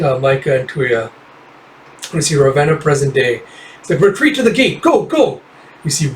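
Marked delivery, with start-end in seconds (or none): 4.0-5.4 high, urgent battle shout; shouted command. Acted. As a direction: yell the retreat over the fighting. Commander calls retreat.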